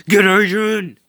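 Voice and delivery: high, loud cartoon battle cry; loud joke shout. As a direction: yell it like a catchphrase.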